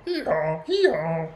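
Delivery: loud two-note hee-haw; donkey braying. A narrator's reading. Storyteller voicing the donkey among the animals, braying. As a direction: bray like a donkey, twice. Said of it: "the donkey going".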